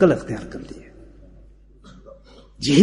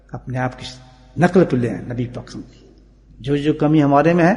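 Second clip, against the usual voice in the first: ordinary talk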